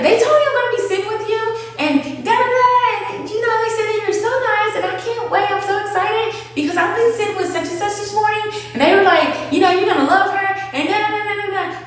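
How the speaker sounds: high, fast, breathless gush; bubbly new hire gushing to her trainer; gushing new girl. Retold or imitated. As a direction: rattle it off like an overexcited newbie.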